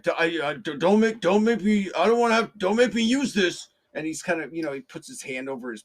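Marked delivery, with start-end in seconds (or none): 0.0-3.7 high, loud, stuttering plea of a scared man; imitated frightened stammer, higher and louder; stammering threat. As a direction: stumble over the words, bluffing in fear. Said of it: "amusing and almost adorable in a very sad way".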